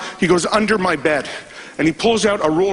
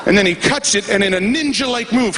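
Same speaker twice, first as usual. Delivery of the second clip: usual speaking voice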